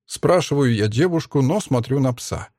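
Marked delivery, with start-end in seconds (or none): none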